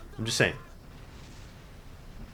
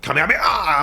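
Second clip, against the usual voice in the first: mock Kamehameha shout; 4 semitones higher than usual. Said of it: "They were like".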